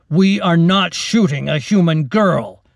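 loud, firm declaration; captain overruling his soldiers loudly. Acted. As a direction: state it loud for the whole squad. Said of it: "the captain said loudly".

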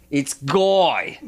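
slightly high mock-Australian self-introduction; imitated Australian accent. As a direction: introduce yourself like a big Australian bloke. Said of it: "I just got suckered by the accent".

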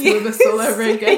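loud, quick, through real laughter; laughing. Real, not acted. Co-host teasing friend about sounding like English class, laughing. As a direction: crack up while getting the words out. Both laughing at school-essay phrasing.

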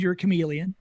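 ordinary delivery

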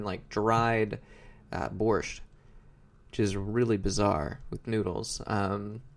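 usual speaking voice

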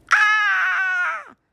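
high, slow terrified scream; piercing scream. Acted. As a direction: shriek in fright. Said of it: "she gave a piercing scream".

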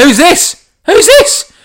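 very high, loud startled yell; alarmed roofer. Acted. Roofer answers a headhunt-style call, alarmed yell. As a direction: yell it, mock freaked-out.

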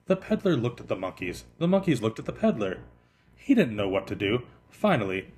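ordinary delivery, nothing marked

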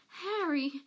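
high, gasped cry; gasping. A narrator's reading. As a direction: gasp his name in relief. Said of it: "gasped Peter".

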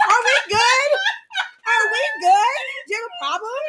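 high, challenging questions; playfully spoiling for a fight; mock confrontation. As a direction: pitch it up, demanding an answer in jest. Joins in the acted confrontation.

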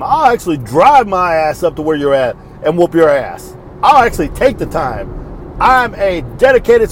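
worked-up retelling of a warning; retold tough threat. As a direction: act out squaring up to a caller. Retelling own threat to abusive caller.

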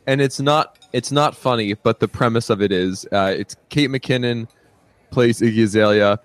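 ordinary talk

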